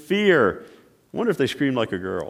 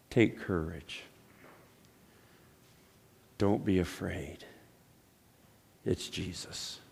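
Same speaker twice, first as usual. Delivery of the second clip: reassuring; 9 semitones lower, 15 dB quieter than usual. Real, not acted. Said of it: "If there's a message here today".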